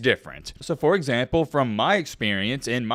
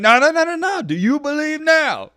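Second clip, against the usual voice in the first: higher, louder mocking no, no, no; imitating Deion; 12 semitones higher, 4 dB louder than usual. Vlogger mimics Deion taunting the reporter, smug. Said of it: "And Deion Sanders like".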